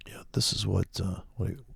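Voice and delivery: hushed aside to himself; whisper. Real, not acted. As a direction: drop to a whisper mid-thought. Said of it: "unvoiced whisper".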